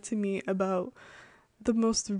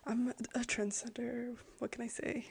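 unvoiced, much softer mock-secret whisper; whispered brag; 3 semitones higher, 9 dB quieter than usual. Real, not acted. Whispering a joke about herself to viewers. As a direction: whisper it like a joking confession. Jokey self-praise aside.

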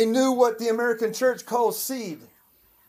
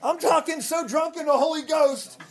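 shouted, higher revival preaching; fiery preaching; 4 semitones higher than usual. Acted. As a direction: preach it out with full fire.

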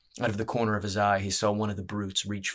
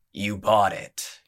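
the spider rumbles a slow jibe at the fighter; rumbled; 7 dB louder, slower than usual. Acted. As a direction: let it roll out slow and heavy. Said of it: "he rumbled".

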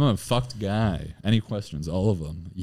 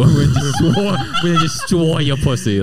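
mock baby voice, rising louder and higher; baby talk; 7 semitones higher, 4 dB louder than usual. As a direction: babyish boast with R's softened to W's, half laughing. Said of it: "still talked like a baby".

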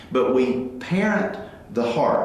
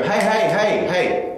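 calling out; 6 semitones higher than usual. Retold or imitated. Dad calling daughter with earbuds in.

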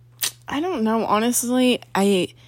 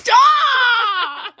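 high squealing shout; shrieking; 13 semitones higher, 5 dB louder than usual. Real, not acted. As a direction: shriek it in embarrassed protest. Reacting to teasing.

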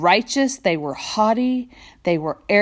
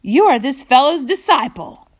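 higher and louder accusation; hurled insult; 6 semitones higher, 5 dB louder than usual. Acted. Pharisees insulting the healed man, higher and louder. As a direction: spit it at him as an insult. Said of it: "They hurled insults at him".

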